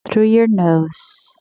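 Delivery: usual speaking voice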